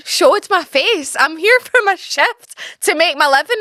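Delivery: indignant retort. Retold or imitated. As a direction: throw the question back, affronted. Retorting to coworker's jab.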